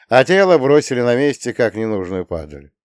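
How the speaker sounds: ordinary delivery, nothing marked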